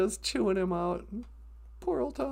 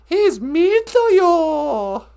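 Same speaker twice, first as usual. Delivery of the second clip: hammed-up bad acting, very drawn out; mock terrible actress, slow and loud; Thomas Builds a Fire's grandma; 3 semitones higher, 7 dB louder, much slower than usual. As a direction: overact it loudly and at half speed. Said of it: "you guys don't know bad acting".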